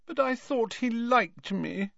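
put-on high, slow, plaintive ghost girl. A narrator's reading. Ghost girl pining for a boy, plaintive. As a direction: lament it in a drawn-out high voice. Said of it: "she said plaintively".

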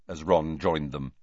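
ordinary delivery, nothing marked

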